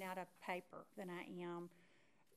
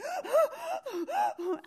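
loud, high sobbing gasps; hammed-up crying jag without words; imitated hard crying; 14 dB louder than usual. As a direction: heave mock sobs, gasping hard. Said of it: "I was doing that. I was crying so hard".

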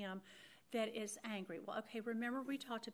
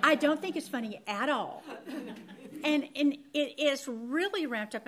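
louder and firm, with a laugh in it; emphatic loud; 3 semitones higher, 11 dB louder than usual. Real, not acted. Telling how his snarky comments land, loud and emphatic. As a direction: push it hard, half laughing. Says his jokes aren't funny.

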